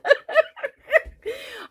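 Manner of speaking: high bubbly giggle. Real, not acted. Crafter giggling at her own joke, high giggle. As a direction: laugh at your own joke. Laughing after quote.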